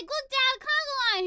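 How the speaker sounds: put-on loud, high-pitched kid-like excitement; reenacted delighted shout; excited calling out. Excitedly calling Dad to see.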